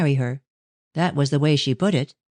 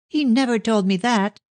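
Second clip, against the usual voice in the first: high mild shriek; 7 semitones higher than usual. A narrator's reading. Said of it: "shrieked Ruth".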